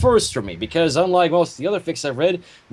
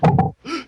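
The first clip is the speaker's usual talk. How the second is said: mock gasp; 9 semitones higher, 3 dB louder than usual.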